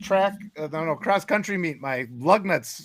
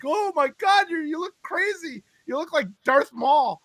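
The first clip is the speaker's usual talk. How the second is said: higher, slow, lightly voiced shocked kids; imitating the kids; 7 semitones higher, slower than usual. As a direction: act out the children gasping at his eye. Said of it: "My kids were like".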